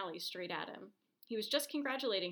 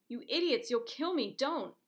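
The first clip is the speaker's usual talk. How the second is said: loud, much higher furious outburst; angry yell; 6 semitones higher, 7 dB louder than usual. Acted. Injured man screams at the crowd lifting him, furious panicked yell. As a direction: yell at them in panicked fury.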